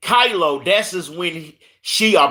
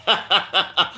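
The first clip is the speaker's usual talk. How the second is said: short run of chuckles; light, chuckling laugh; self-amused laugh; 2 semitones higher than usual. Real, not acted. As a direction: laugh at your own fumbled attempt. Laughs at own pronunciation attempt.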